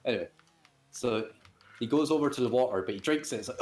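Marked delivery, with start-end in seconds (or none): none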